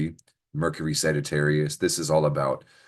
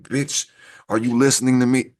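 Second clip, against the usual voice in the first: higher, louder mock call for attention; mock demanding; 6 semitones higher, 5 dB louder than usual. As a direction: playfully demand they listen. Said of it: "I'm gonna use that more often and be like".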